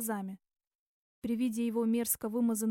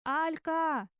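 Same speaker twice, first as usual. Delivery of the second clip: higher-pitched drawn-out name call; 5 semitones higher than usual. A narrator's reading. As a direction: call after her from behind. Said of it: "услышала она". (протяжный оклик высоким голосом)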